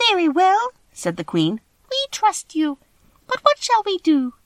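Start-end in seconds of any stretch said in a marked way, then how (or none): 0.1-0.7 very high, squeaky little queen voice; tiny mouse Queen. Acted. Mouse Queen answering the Scarecrow, high and squeaky. 1.9-4.3 very high, squeaky little queen voice; tiny mouse Queen. Acted. Mouse Queen answering the Scarecrow, high and squeaky.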